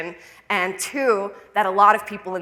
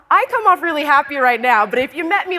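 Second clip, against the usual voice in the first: ordinary delivery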